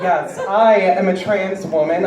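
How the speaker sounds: ordinary delivery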